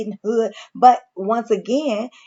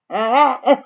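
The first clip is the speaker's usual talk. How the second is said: real, higher-pitched laugh; amused laugh; 4 semitones higher than usual. Real, not acted. Vlogger amused at how different he looks, real laugh. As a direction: crack up briefly. Amused by the man's new look.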